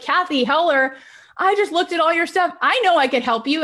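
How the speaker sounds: high, excited retold Angela; eager pitch. Angela pitching herself to Kathy, high and eager. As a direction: sell yourself with bright enthusiasm.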